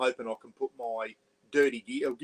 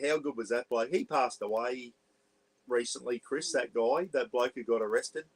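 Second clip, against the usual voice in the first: ordinary talk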